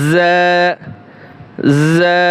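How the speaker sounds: usual speaking voice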